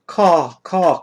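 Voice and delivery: high, loud crow cry; crow caw. Acted. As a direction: caw like a shrieking crow over graves. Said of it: "crows shriek".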